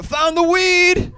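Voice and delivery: high, loud, stretched-out dog voice; slow squeal of a thrilled dog; excited drug dog. Acted. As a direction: proudly holler the find as a dog. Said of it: "the dog was like".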